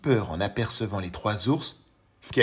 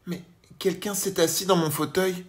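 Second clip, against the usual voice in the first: higher mother bear, lightly voiced; gentle Mama Bear voice; 8 semitones higher, 4 dB louder than usual. A narrator's reading. Mama Bear answers her husband, in a gentle mother-bear voice. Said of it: "de sa voix douce".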